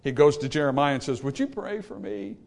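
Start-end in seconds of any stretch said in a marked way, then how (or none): 1.2-2.4 soft, timid asking; meek plea. Retold or imitated. King Zedekiah meekly asks Jeremiah for prayer, quietly. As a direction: ask quietly like a man too proud to repent. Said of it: "that's how he said it".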